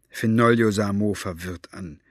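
plain reading voice

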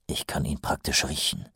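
low, quiet, brooding captain; Vimes brooding. A narrator's reading. (leise grübelnder Hauptmann)

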